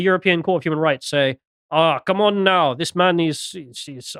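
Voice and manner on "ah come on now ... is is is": slow, put-on French accent; mock French accent. Host plays the European court objecting, mock French accent. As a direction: plead in a hammed-up French voice. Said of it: "I don't know, they're French as well".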